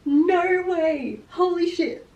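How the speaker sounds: real giddy shock; thrilled disbelief. Real, not acted. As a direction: she was right all along. Overwhelmed by the twist.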